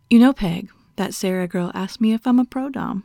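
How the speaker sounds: usual speaking voice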